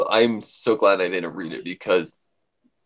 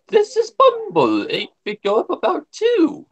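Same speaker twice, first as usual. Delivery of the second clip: Bumble voice; 3 dB louder than usual. Shown as a demonstration. Showing the Bumble character voice pitched way up. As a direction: push the voice way up for Bumble. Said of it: "if you go up on it".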